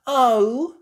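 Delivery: high, loud oh; gasp. A narrator's reading. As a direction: gasp with envy. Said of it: "gasps Peppa".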